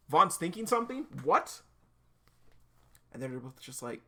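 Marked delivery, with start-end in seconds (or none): 0.1-1.6 high, loud incredulous question; baffled surprise. Acted. Reacting to Vaughn thinking something, baffled surprise. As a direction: rise sharply in disbelief.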